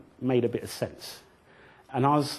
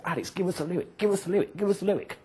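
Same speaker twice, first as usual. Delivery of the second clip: crowd calling; 6 semitones higher, faster than usual. Retold or imitated. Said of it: "and he said".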